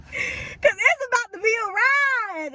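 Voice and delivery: high, slow, half-crying shout of joy; drawn-out ecstatic cry; excited shout. Real, not acted. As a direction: belt it out like the best news ever. Excited hype to viewers.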